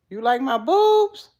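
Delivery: loud, slow, incredulous question; shocked question. Real, not acted. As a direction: ask it scandalised. Loud shocked reading of comment.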